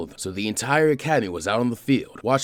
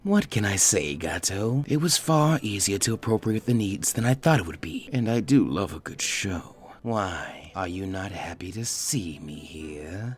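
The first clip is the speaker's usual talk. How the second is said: sinister snake voice; 2 semitones lower, slower than usual. Acted. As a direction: purr it like a snake toying with prey. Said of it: "there was an air of danger to it".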